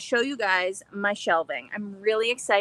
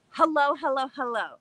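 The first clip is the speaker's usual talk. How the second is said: ordinary talk, nothing marked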